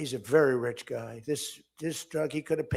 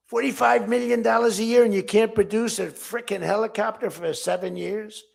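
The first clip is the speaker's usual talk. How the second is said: loud, high outraged rant; retold angry scolding, loud and high; indignant shout; 7 semitones higher, 11 dB louder than usual. Scolding defense contractors, retold.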